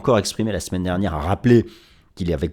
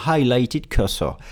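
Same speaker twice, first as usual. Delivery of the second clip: English words in a put-on British accent; self-mocking posh British English; 3 dB louder, much slower than usual. Shown as a demonstration. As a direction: say it like a Brit, slowly. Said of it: "avec mon superbe accent".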